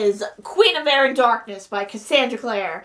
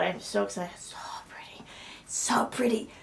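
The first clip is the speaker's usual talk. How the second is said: hushed, breathless whisper; awed whisper; 3 semitones lower, 10 dB quieter than usual. Real, not acted. Admiring the new book to viewers, awed whisper. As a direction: whisper it in real awe.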